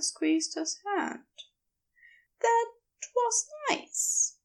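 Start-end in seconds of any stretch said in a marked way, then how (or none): 2.4-4.4 higher, airy voice for the shy, happy man; Aziraphale, lightly voiced. Aziraphale after the cheek kiss.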